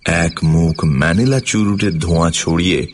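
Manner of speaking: plain reading voice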